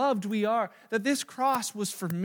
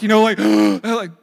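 mock gasp; 12 dB louder than usual. Mimics boys' shocked look.

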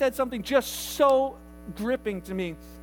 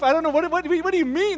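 loud, high, flustered reply; hammed-up confusion; mock bafflement; 8 semitones higher, 8 dB louder than usual. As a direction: play the thrown-off stranger. Acting baffled patients answering Shirley.

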